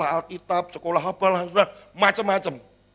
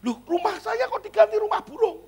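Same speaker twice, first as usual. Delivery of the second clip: much higher, stunned squeal of disbelief; high-pitched indignant shock; shocked homeowner. Acted. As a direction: play the outraged owner who can't believe it. Owner finds house changed.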